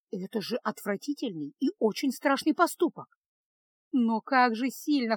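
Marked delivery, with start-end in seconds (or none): none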